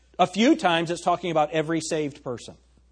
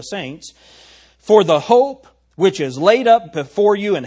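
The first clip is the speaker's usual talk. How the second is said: Bible reading that swells louder; scripture reading building to louder preaching; 5 dB louder than usual. Acted. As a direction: read the verses and let them build in force. Scripture read aloud, building.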